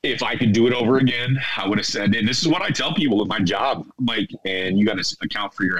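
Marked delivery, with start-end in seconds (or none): none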